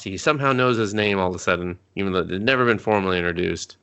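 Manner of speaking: ordinary talk, nothing marked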